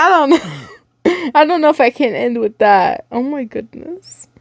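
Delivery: high, slow, tearful voice; crying. Real, not acted. Reader crying after the story. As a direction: choke out the words. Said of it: "make me cry".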